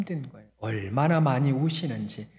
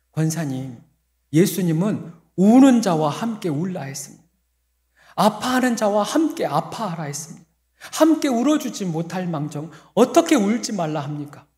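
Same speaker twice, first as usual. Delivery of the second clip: mock protest; 5 semitones higher, 6 dB louder than usual. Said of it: "라고 말은 못했어요".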